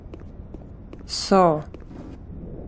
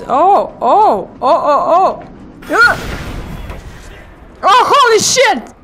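loud high disbelieving exclamation; shocked yell; 8 semitones higher, 4 dB louder than usual. Real, not acted. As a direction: blurt it out in shock. Shocked by game event.

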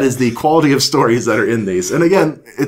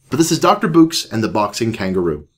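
usual speaking voice

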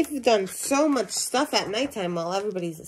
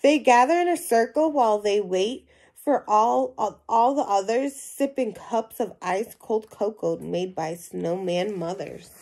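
lively storybook reading to a class; animated read-aloud voice for young listeners; reading to children; 5 dB louder than usual. Acted. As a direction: read it out like a picture book for kids. Teacher reads picture book aloud.

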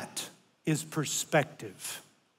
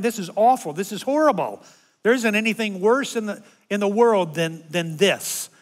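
loud whiny griping, higher; complaining; 4 semitones higher, 4 dB louder than usual. Retold or imitated. Grandpa complaining to family about bad seats, loud whiny gripe. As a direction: grumble loudly like a spoiled fan. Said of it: "I start complaining".

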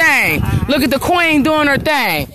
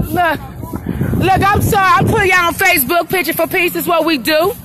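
excited; 7 semitones higher than usual. Real, not acted. Vlogger pumps up Facebook viewers, excited. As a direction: hype up the live audience. Hyped while filming live.

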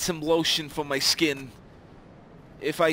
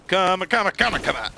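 "come" shouted louder; loud taunt; 4 semitones higher, 7 dB louder than usual. Acted.

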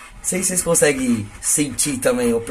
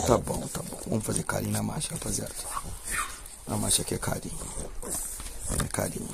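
soft cooing; 5 semitones lower, 12 dB quieter, much faster than usual. Real, not acted. Soft talk petting dog.

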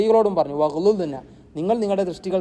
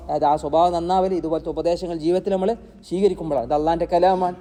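ordinary talk

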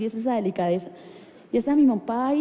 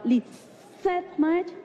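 slow, higher-pitched savouring; drawn-out wondering dad voice; dad trying out a name; 5 semitones higher, much slower than usual. Retold or imitated. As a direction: roll the name out slowly, impressed. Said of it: "Y le dice mi papá".

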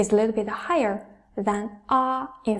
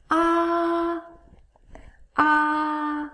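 "a" held very high and level; high first tone; 5 semitones higher than usual. Shown as a demonstration. Teacher repeats the highest tone, high sustained "a". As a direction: raise "a" up and keep it steady. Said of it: "raise up your pitch".

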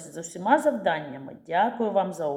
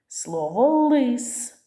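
slow, louder, drawn-out word; slow word demo; 5 semitones higher, 5 dB louder, much slower than usual. Shown as a demonstration. Teacher to children, slowly and loudly sounding out a word. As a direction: stretch each sound for the class. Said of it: "Який перший звук?".

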